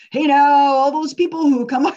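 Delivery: loud, high pulpit preaching; hammed-up fire-and-brimstone preacher; loud mock sermon from the pulpit; caricature preacher. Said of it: "I'm gonna do a caricature here".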